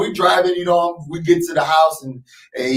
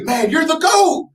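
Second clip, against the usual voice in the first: much higher and slower, a big drawn-out cheer; hyped praise; 11 semitones higher, slower than usual. Retold or imitated. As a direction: shout it like you're lifting a kid up. Imagined hyping up young Jordan.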